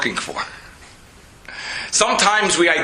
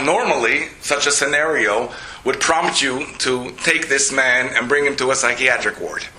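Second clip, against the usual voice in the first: ordinary talk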